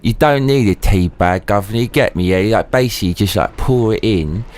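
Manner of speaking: chatty Cockney geezer, rising in pitch; Cockney banter. Acted. Streamer playing a geezer offering auntie a cuppa, Cockney banter. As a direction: put on a matey Cockney accent.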